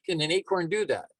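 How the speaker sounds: ordinary delivery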